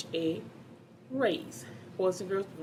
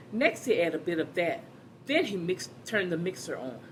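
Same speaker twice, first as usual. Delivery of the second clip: lively, animated storybook reading; reading to children. Acted. Teacher reading a picture book to the class, animated. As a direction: read it out to a class of kids with bounce.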